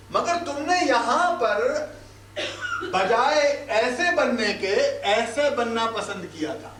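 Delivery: normal talking voice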